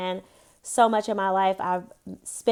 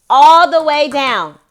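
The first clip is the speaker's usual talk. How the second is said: slower, stern repeated order; louder, slower order; 6 semitones higher, 14 dB louder, slower than usual. Real, not acted. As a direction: insist louder, slower and firmer.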